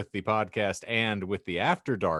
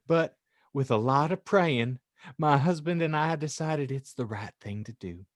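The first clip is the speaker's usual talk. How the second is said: hammed-up churchy homemaker greeting friends; raised, sugary storytelling voice; earnest author persona; 7 semitones higher than usual. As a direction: read it as a pious confession to friends. Reads author's note in character.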